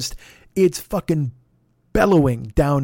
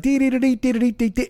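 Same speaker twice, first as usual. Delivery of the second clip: guitar lick imitation; 9 semitones higher, faster than usual. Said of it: "it gets like".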